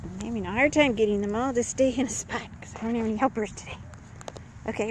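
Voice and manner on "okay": ordinary delivery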